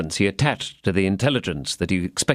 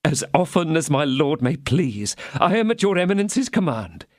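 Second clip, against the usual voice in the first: higher, pleading little mercer voice; fawning mercer; 7 semitones higher than usual. A narrator's reading. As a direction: grovel to the cardinal in a thin voice. Bonacieux voiced.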